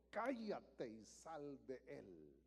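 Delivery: slow, low, soft stern rebuke; slow low commanding rebuke; Jesus rebuking. Acted. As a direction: command it quietly with authority.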